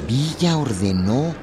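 usual speaking voice

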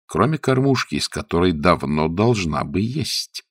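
ordinary delivery, nothing marked

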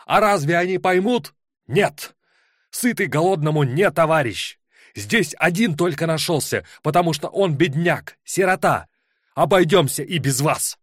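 high, loud furious attack. Acted. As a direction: lash out at the whole hall. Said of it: "Яростно накинулся он на собрание".

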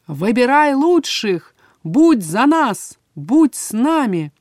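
slow, loud proclamation; proclaiming. A narrator's reading. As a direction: call it out slowly and loudly. Magic writing in the air.

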